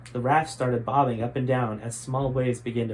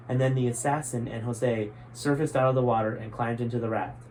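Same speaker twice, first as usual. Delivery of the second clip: usual speaking voice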